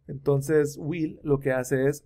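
ordinary talk

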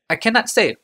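careful servant choosing his words, pitched high; Hajar's voice. A narrator's reading. Hajar answers the Kadir.

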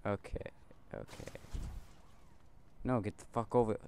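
ordinary talk, nothing marked

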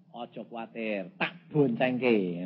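soft, low, slowing reassurance; reassuring husband. Retold or imitated. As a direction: soothe a hesitant wife gently. Husband reassures his hesitant wife.

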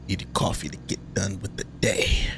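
breathy whispered read of the in-game thought. Acted. Gamer whispering the character's thought to himself. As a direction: hush it like a private thought.

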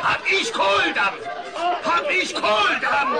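high, slow bellowing of "Kohldampf"; mock hungry bellow. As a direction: bellow it like storming a buffet. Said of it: "kick ich die Tür auf und brülle".

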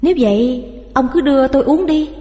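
bà vợ vui tươi. A narrator's reading. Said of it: "Bà Tâm tỏ vẻ vui tươi".

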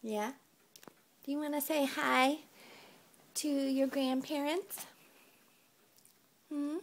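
put-on high sing-song baby talk; reading to a toddler. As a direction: coo it to a little one. Parent talking to toddler.

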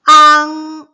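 high, loud 앙 called out; loud model syllable. Shown as a demonstration. Teacher modelling 앙 loudly for kids. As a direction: belt out 앙 for the class. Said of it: "구 번".

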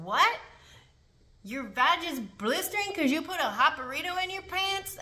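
can't believe what she's hearing; shocked disbelief. Retold or imitated. Officer reacting to the inmate's burned story, shocked.

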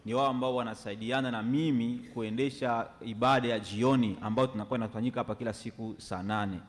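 normal talking voice

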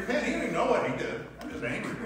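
real laughter breaking into the quote; chuckling while quoting his own grudge; laughing through it. Real, not acted.

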